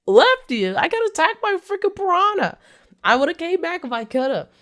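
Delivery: high, loud defensive protest; hammed-up angry rebuttal; mock indignant yell. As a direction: shout back in outrage, overplayed. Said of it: "He's like".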